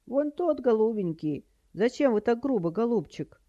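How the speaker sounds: high, plaintive granny voice; high-pitched doddery complaint; batty old woman. A narrator's reading. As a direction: whine reproachfully as a frail old lady. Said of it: "прекрасно изобразила полоумную старуху".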